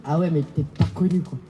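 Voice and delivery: imitated friend, low and quick, utterly self-assured; disconcerting aplomb. Friend bluntly judging the singer's fame, retold with breezy aplomb. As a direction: toss off the verdict fast and low, with total aplomb. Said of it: "avec un aplomb déconcertant".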